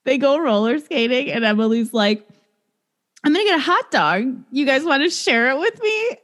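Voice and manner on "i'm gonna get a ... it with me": lightly voiced chipper offer, higher; imitated eager friend. Emily offering friends a hot dog, lightly acted eagerness. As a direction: pitch it up, innocently eager.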